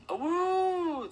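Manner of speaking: high, loud sustained wolf howl. A narrator's reading. Wolves draw closer, loud high howl. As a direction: howl long and high at full volume. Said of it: "the howls grew louder".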